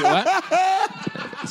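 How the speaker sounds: high laughing what. Real, not acted. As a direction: burst out laughing in disbelief. Mock argument, laughing.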